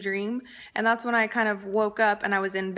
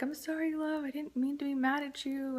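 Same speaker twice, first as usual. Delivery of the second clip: soft, higher, hushed sorry to a sleeping husband; softly apologizing to her sleeping husband, hushed; quiet apology; 5 semitones higher, 5 dB quieter than usual. Retold or imitated. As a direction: murmur it gently so he stays asleep. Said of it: "I was talking to him very quietly".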